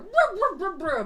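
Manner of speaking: high, loud mock dog barking; imitated dog growl-bark. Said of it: "dogs behind the fence are just going".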